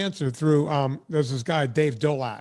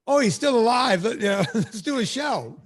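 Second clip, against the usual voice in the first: retold excited; 6 semitones higher than usual. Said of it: "I went".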